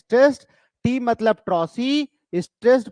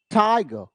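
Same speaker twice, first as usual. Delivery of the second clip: टाइ of tiger held long, then a light falling गर; stress on first syllable. Shown as a demonstration. Teacher scanning the poem for students, first syllable of tiger stressed. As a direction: stretch the opening syllable of tiger. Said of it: "पहला syllable क्या है? Stressed है".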